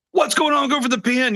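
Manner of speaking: a hypothetical commentator erupting over the pin, mock shout; sudden mock shout. Dominic on commentary, imagined bursting out about the pin, mock high shout. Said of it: "but then all of a sudden shout".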